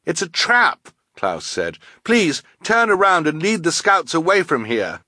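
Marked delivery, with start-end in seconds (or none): 0.0-0.9 high, urgent warning; young boy raising his voice; alarmed boy. Acted. Klaus warns the scouts. 2.0-5.0 high, urgent plea; young boy pleading, voice raised; alarmed boy. Acted. Klaus pleads with the scout leader.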